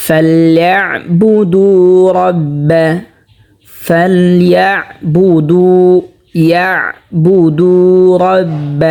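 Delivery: very slow, drawn-out tilawat chant; slow melodic Quranic recitation. Shown as a demonstration. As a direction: recite each word with long held vowels. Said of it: "যেমন".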